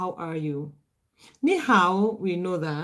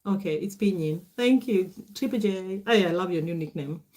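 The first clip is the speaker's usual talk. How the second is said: ordinary delivery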